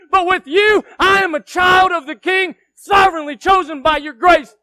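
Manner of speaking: loud, high, fervent preaching; yelled preaching. Real, not acted. Preacher praising God at the top of his lungs, yelled preaching. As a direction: pour all your energy into shouted praise. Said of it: "'cause I yelled".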